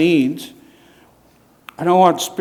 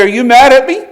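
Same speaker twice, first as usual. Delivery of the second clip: higher, louder worried question; anxious pleading; 8 semitones higher, 7 dB louder, faster than usual. Retold or imitated. Marcella asking him if he is mad, worried and pleading. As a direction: plead like a hurt friend. Marcella phones, feeling neglected.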